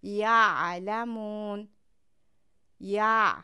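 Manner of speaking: یعلمون stretched very slowly, ع pressed from mid-throat; throat letter demo. Shown as a demonstration. Teacher demonstrating ع for Quran students, slow. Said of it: "از نصف گلو تلفظ کنید".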